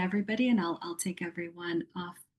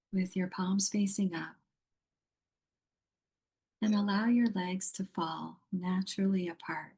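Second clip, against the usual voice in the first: guided meditation. Acted. Yoga teacher guiding a muted online group into yoga nidra, meditative. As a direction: lead them gently into rest, phrase by phrase.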